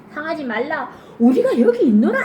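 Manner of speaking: rising to a high, loud cry; loud shout. Acted. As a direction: call out across the dark prison. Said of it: "바울이 크게 소리질러". (간수에게 여기 있다고 크게 외치는 바울)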